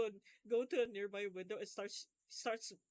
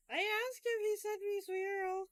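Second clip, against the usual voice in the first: high, slow mock mom quote; imitating the son's voice; 8 semitones higher, much slower than usual. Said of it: "The fucking son's voice".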